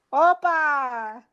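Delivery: high, drawn-out mock shout of celebration; playful cheer. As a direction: call it out like smashing a plate at a Greek party. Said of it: "tirar el plato y gritar".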